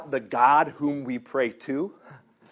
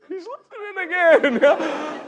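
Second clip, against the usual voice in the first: slow, higher, laughing through the words; laughing delight; 8 dB louder, slower than usual. Real, not acted. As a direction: giggle it out, amazed it's working. Delighted his story works.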